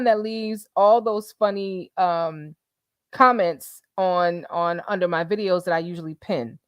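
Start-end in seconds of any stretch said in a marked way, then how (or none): none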